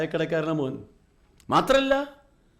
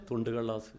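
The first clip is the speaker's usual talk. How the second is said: much lower and softer, a flat ATM answer; deadpan machine reply; 9 semitones lower, 10 dB quieter than usual. Retold or imitated. The ATM answers the waiting mother, low and deadpan. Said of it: "എന്താ പറഞ്ഞേ?".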